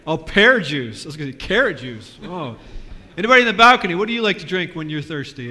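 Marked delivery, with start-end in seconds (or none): 3.2-5.5 loud and higher, calling up to the balcony. Real, not acted. Pastor calling up to the balcony, loud. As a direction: throw the question up to the balcony.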